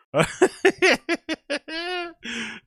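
long, very high-pitched giggle; high laugh. Real, not acted. Laughing at nobody smiling in the picture, high giggle. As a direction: let out a drawn-out high laugh.

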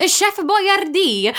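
high, drawn-out mock-Italian flourish; joking. Shown as a demonstration. Messing with people who ask for Italian, joking flourish. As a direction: stretch it out grandly, as a tease. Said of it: "I was gonna jokingly say".

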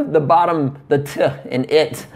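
normal talking voice

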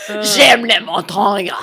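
slow, loud fake French accent; mimicking the CD French for her cohost; heavy French accent. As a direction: savour it like a language CD in heavy French. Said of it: "this really heavy, terrible accent".

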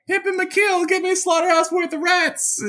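high squeaky rat king. Retold or imitated. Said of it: "the King of Rats was like".

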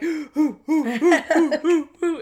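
high rhythmic hoo-hoo whoops breaking into laughter; mimicked whooping. Host imitating the wing flap, high laughing whoops. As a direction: whoop repeatedly like a flapping mascot.

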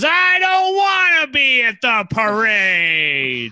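slow whiny pout; whiny complaint. Retold or imitated. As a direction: whine it like a sulky kid. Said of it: "And he goes".

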